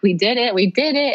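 rising from mild to high-pitched elation; joyful relief. Retold or imitated. Mother picking up her newborn son, joyful relief. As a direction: retell a triumphant cry without shouting.

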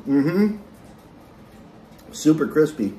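chewing while talking; speaking between bites; mouth full. Real, not acted. Seen 1: Bit pizza at -4; mouth closed, chewing while saying mm-hmm.

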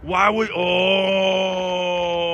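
long, slow wail of disappointment; anguished drawn-out cry. Real, not acted. Gambler groaning at a lost spin, slow anguished wail. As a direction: stretch it out in real dismay. Slot spin just missed, upset.

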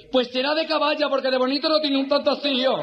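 high, indignant effeminate retort; camp army clerk. Acted. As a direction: fire back in a hammed-up camp voice. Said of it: "Y hace así la mariquita".

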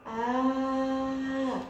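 "آه" held as one long, level aa; long madd sound. Shown as a demonstration. Teacher demonstrating alif madd, "آه" as a long held aa. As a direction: sustain the vowel of "آه" very slowly and evenly. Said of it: "إنه صوت طويل".

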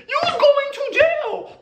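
high, laughing squeal of jail; squealed verdict. Real, not acted. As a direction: shriek the sentence in delight. Verdict on the sixteen-year-old.

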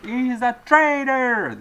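slow, high, shouted accusation; imitated street yell. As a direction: bellow it like the man in the street. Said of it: "Somebody was yelling on the street".

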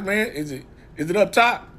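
ordinary talk, nothing marked

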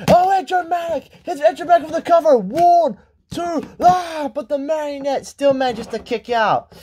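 high, urgent pinfall count; frantic wrestling commentator; excited play-by-play. Acted. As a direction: shout the near fall, then ease off. Live play-by-play, near fall.

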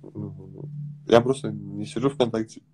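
ordinary talk

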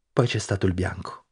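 usual speaking voice

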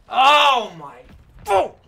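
sudden high, very loud outburst; shocked yelling. Real, not acted. Player reacting to the match, shocked high yell. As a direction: yell out in high-pitched disbelief.

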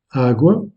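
"agua" with the stress on the first A, AH-gwa; Portuguese pronunciation. Shown as a demonstration. Teacher to French learners, "agua" stressed on the first A. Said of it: "L'accentuation est faite sur la première syllabe".

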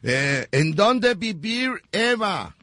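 sing-song pretend English question; mock English. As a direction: ask it like broken English. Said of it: "Háblame en inglés".

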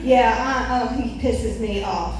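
ordinary talk

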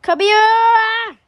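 yelling for dad across the house; calling out loud. Pretend. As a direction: call him in, loud and high. Dad, wherever you are.

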